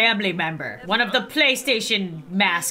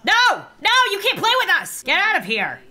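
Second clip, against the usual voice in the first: louder, fast shooing shout; mock outrage; 8 semitones higher, 4 dB louder than usual. Real, not acted. Gamer shooing an unwanted Sim from the table, hammed-up outrage. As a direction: chase the intruder off the table.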